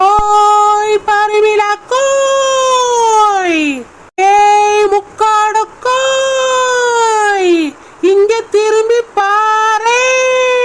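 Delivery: drawn-out sung call across a field; long calling out. Acted. Village woman calling her friend from afar, drawn-out sung call. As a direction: stretch each word like a far call.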